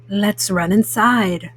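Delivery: a mellow cool cat suggesting fun; Pete the Cat voice. Acted. Mom reading to kids, voicing cool cat Pete.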